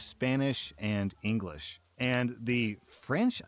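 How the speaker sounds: ordinary talk, nothing marked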